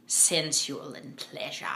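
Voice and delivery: slow, soft mock upper-class British; upper-class British accent. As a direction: imitate the narrator's milked British vowels. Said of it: "again, with that accent".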